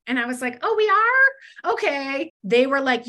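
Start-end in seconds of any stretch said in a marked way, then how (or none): 0.6-2.3 high, drawn-out questioning surprise; taken aback, then agreeing uncertainly; surprised. Retold or imitated. Newcomer told of morning prayer calls, surprised.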